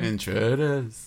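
drawn out, dramatic; slow ominous echo. Retold or imitated. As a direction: linger menacingly on it. Echoing the line.

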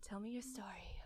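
playful whisper. Real, not acted. Host prompting story in whisper.

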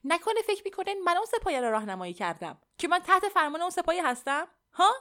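loud, high-pitched angry outburst; very harsh. A narrator's reading. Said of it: "با خشونت بسیار گفتم". (با خشونت بسیار و صدای بلند)

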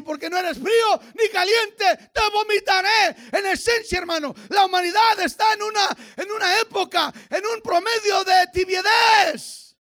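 high, loud revival preacher; full-force preaching. Acted. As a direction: preach it hard, drawing out key words.

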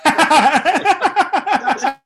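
loud, high, slow laughing words; can barely speak for laughing. Real, not acted. Comic laughing hard while telling his friend the story. Cracking up retelling.

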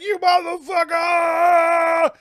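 high, slow, stretched-out scream; mock furious yell. Man charging the robot, hammed-up high yell. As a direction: ham up a furious war cry.

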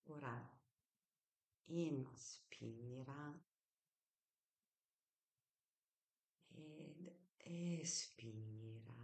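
slow, soft breathing cue; guided breathing. Shown as a demonstration. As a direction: stretch each word like a breath. Leads a breathing exercise.

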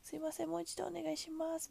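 overly sorry plea to hear again; extra apologetic. Retold or imitated. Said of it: "申し訳なささを、あの、三倍ぐらいにして".

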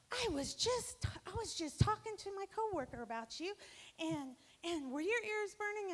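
high, loud and breathless with surprise; excited gush. Retold or imitated. Friend gushes that she was just talking about her. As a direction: burst out delighted to see her.

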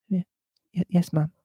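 soft, stammering timid servant; diffident. A narrator's reading. Old servant answers her mistress, softly stammering. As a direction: stutter a small, meek reply. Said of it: "then added diffidently".

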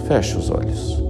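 soft whispered command. Acted. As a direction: breathe the words out gently. Whispered eye-closing cue.

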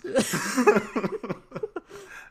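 high wheezing laugh; laughing hard at his own joke; real giggle. Real, not acted.

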